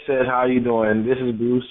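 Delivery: ordinary delivery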